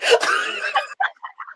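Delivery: giggling squeal, very high; real giggle fit; high squealing laugh. Real, not acted. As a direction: let out an embarrassed squeal of laughter. After cursing on live.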